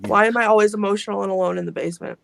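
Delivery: rattled off quickly, half joking; quick, wry self-mockery; fast self-mocking complaint. Real, not acted. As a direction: rush it out with a wry laugh in the voice. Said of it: "I'm emotional".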